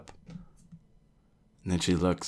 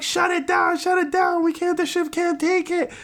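high frantic shout, much louder; panicked shout; 5 dB louder than usual. Retold or imitated. Crew yelling as the ship overloads, panicked shout. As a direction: yell to shut the machine down.

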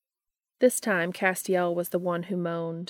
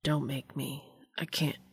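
pleading whisper; 3 semitones lower, 5 dB quieter than usual. A narrator's reading.

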